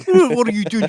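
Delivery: garbled mock South African accent; Chris Van Heerden. Mocking a boxer's talk, garbled South African accent. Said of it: "have y'all heard Chris Van Heerden talk".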